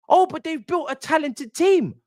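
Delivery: very high-pitched mock whine; mocking imitation. Fan mimicking others' defence of the directors, mockingly. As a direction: parrot the argument in a ridiculous high voice. Mocking the fans' recurring argument.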